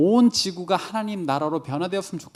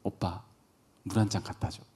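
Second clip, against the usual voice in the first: soft, low imitation of a wife; payback request; 7 semitones lower, 8 dB quieter, faster than usual. Wife wanting payback for yesterday's loss, soft imitated request. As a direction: quietly ask like you're owed one. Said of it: "보상받고자 하는 뜻으로".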